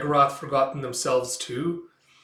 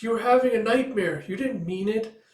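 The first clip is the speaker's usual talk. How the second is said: put-on high, young-sounding voice; pitched-up little helper, eager to excuse; earnest little fox; 9 semitones higher, 4 dB louder than usual. Little fox to Micah after the nightmare, earnest and high.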